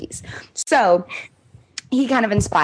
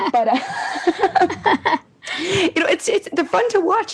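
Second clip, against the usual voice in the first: laughing; 13 semitones higher, much slower than usual. Real, not acted. Laughing about loving squirrels.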